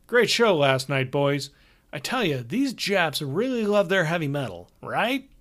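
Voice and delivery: brash, cheerful tour manager; hearty road manager. Acted. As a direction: play the chummy older road manager. Hogarth voice again.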